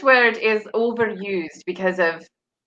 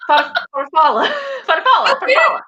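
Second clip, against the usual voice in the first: laughing pronunciation; 10 semitones higher than usual. Shown as a demonstration. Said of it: "If I try to say it with it".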